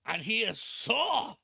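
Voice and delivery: Van Helsing angry. Acted. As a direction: build to a loud, drawn-out accented rage. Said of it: "his voice raises like this".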